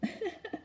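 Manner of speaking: light high chuckle; short breathy chuckle; amused laugh. Real, not acted. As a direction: giggle at your own storytelling. Amused by her own yell.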